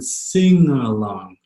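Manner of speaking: "sing along" with no hard g; "sing along" as sing-uh-long, g silent; soft NG. Shown as a demonstration. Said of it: "It's a soft G".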